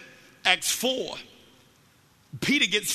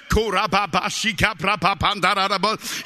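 rapid babbled syllables, louder; imitating speaking in tongues; 6 dB louder than usual. Retelling the man's outburst in the car park, imitated tongues. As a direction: rattle off mock tongues in a loud stream. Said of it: "start hearing out of his mouth".

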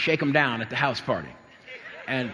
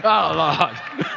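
loud, laughing plea to escape; hammed-up mortified groan; laughing mock panic; 5 semitones higher, 4 dB louder, much slower than usual. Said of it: "I said".

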